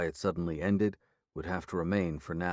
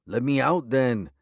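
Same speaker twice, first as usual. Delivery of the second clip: raised, louder and higher angry demand; 5 semitones higher, 6 dB louder than usual. A narrator's reading. Werewolf prisoner angrily demands the sheriff let him out. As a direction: shove it back at her, furious.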